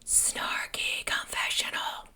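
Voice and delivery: slow whispered segment name. Acted. Announcing her podcast segment, in a slow whisper. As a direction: hush it like a secret title.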